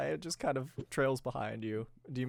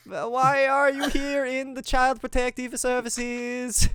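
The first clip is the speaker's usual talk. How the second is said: much higher and louder squeaky voice; higher Mario voice; 10 semitones higher, 6 dB louder than usual. Pretend. Asking ghost kids why they're there, squeaky high. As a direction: push the voice way up. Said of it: "this voice should be probably a higher".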